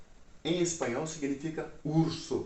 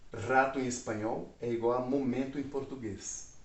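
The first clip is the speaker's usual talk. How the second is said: ordinary delivery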